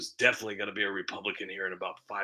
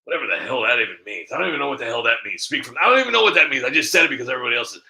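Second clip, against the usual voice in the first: rushed, louder, laughing at himself; fast self-mocking; 6 dB louder, much faster than usual. Real, not acted. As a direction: tumble it out, amused at yourself. Laughing at his own phrase.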